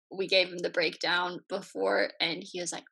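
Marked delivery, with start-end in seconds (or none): none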